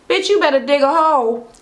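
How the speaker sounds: ordinary talk, nothing marked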